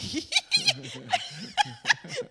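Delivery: laughing at herself; high laugh. Real, not acted. Laughs at own anger.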